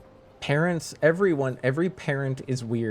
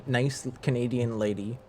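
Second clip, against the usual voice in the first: ordinary talk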